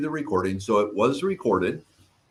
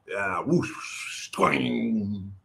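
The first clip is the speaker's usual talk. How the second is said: soft whoosh then a breathy twang; playful whoosh-and-twang sound effect; imitating flying chancla; 4 dB quieter, much slower than usual. Shown as a demonstration. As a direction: sound out a sandal spinning through the air. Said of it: "That's my imitation of a flying chancla".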